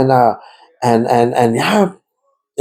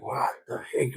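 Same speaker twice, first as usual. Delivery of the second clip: whispered in disbelief, barely voiced; stunned whisper; 17 dB quieter, slower than usual. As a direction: breathe the words out in shock, nearly silent. Retold stunned inner reaction.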